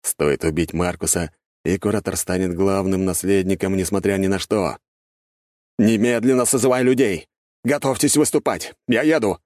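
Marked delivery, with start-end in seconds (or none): 5.8-9.4 shouting orders. A narrator's reading. Curator barking orders by phone.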